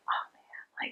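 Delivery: whispered guilty aside. Retold or imitated. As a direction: whisper your dismay to yourself. Guilty realisation told in whisper.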